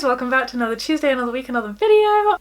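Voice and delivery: quick, loud, cheery welcome; cheery greeting. Real, not acted. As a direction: greet your viewers brightly, quick and upbeat. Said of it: "I'm in such a cheery demeanor".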